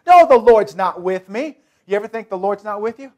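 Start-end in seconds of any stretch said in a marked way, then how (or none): 0.1-1.6 making excuses. Acted. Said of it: "he's making all kinds of excuses".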